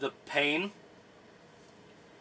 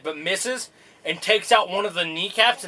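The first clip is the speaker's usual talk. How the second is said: normal talking voice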